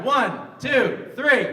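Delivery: high, loud cue to the crowd; loud count-in. Real, not acted. Comic cueing the crowd to shout together, loud count-in. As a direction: call the count so everyone joins in.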